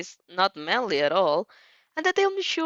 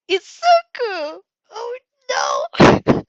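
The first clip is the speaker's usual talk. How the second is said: high, loud, drawn-out happy cry; overjoyed greeting, pitched way up; squealing delight; 11 semitones higher, 8 dB louder, much slower than usual. Real, not acted. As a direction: fangirl squeal at the name. Delighted to see Izuku.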